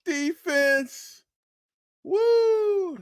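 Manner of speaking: high, loud joking cheer; playful high-pitched hype with a whoop; mock cheering. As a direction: cheer for the defense in jest, drawing it out. Mock cheering, then "just kidding.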